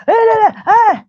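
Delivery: very high mock tutting eh eh eh; exasperated eh eh. Boss clucking in dismay at bad board writing, high mock tut.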